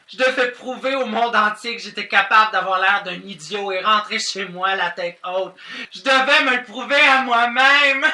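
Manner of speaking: high, loud, building to a wail; overwrought confession. Acted. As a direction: build dramatically toward a breakdown. Emotional speech building to tears. (confession théâtrale, au bord des larmes)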